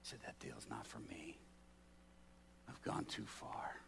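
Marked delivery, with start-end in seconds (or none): 0.2-1.4 whispered, much softer refusal; hushed whisper. Retold or imitated. Prisoner repeats refusal hushed. 2.7-3.8 slow whispered confession; hushed whisper. Retold or imitated.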